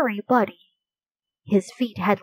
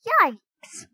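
high, startled yelp; 13 semitones higher than usual. Acted. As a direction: jump at the sudden noise. Sudden harsh cackle startles Annie.

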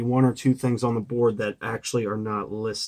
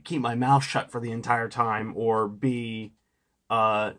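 ordinary delivery

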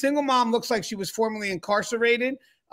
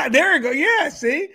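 high bright laughing reply; delighted laughing voice; 8 semitones higher, 6 dB louder than usual. Real, not acted. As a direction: laugh through it, pitched way up.

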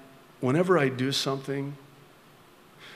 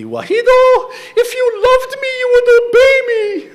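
very high, loud, crying mother's plea; mom's thick accent; 16 dB louder than usual. Retold or imitated. Mother pleading with her disobedient child, high tearful wail. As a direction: wail it like a heartbroken accented mom. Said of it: "mom in her thick accent would always say to me".

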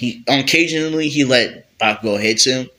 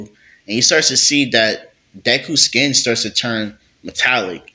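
ordinary delivery

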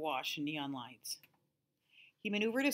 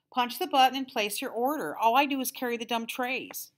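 higher, louder bored car hop; bored annoyance; 6 semitones higher, 8 dB louder than usual. A narrator's reading. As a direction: scold him like a fed-up waitress.

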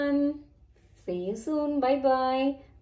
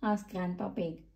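plain reading voice

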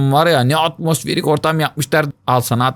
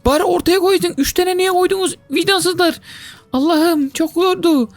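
high, whining lament; whiny complaint; 13 semitones higher than usual. Real, not acted. Gamer whines to viewers about the level. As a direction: whine like you're close to tears.

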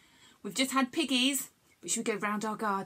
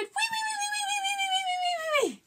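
very high, squealing wee wee wee; tickling piggy squeal. Acted. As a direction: squeal like a little piglet all the way home. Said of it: "this little piggy cried".

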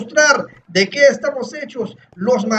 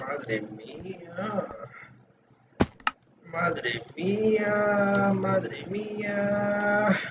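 low, slow and very soft; hushed awe; 5 semitones lower, 13 dB quieter, much slower than usual. Real, not acted. Gamer tense at a parkour jump, hushed awe. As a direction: draw it out under your breath, low.

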